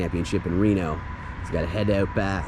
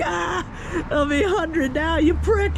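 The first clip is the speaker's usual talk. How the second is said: very high, hammed-up wail; mock pained yelp; slower than usual. Vlogger acting out hot coffee in the eyes, mock pained yelp. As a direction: imitate a scalded man crying out, way up high.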